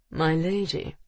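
plain reading voice

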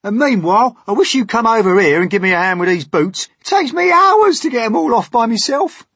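high, loud, hurried centipede shouting; brash centipede. Acted. Centipede demanding James help with boots, brash shout.

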